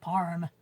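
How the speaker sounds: PARM with an R sound put into palm; added R. Retold or imitated. Mum relays son mocking her Bristolian accent, PARM with added R. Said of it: "putting R's into words where there are no R's".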